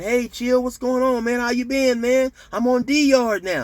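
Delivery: high-pitched chummy cellmate impression; breezily friendly greeting. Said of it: "spoke to me like nothing had ever happened".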